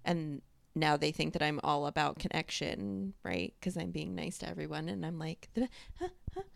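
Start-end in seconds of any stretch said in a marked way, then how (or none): 5.5-6.4 high, slow, sugary cashier greeting; customer service voice. Retold or imitated. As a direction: put on the chirpy service voice. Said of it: "do you know how much I hate my own customer service voice".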